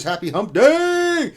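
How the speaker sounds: loud, high, drawn-out holler; hyped shouted greeting. Acted. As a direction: holler it high, stretching the last word. Hyped reading of chat greeting.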